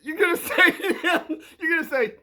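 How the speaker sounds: high-pitched, laughing through the setup; cracking up. Real, not acted. Coaching a kid on what to say, cracking up. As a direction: try to speak over real laughter. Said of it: "I'm sorry, I, I'm, I'm trying to stay".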